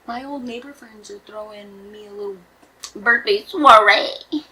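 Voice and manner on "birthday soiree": fancy drawl. Real, not acted. Drawn-out fancy word.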